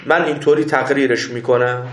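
ordinary talk, nothing marked

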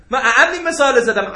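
usual speaking voice

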